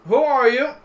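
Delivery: loud, high-pitched guard's challenge. Acted. Guard spots the newcomers, sharp loud challenge. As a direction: call it out high and loud, questioning. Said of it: "look over at you and he goes".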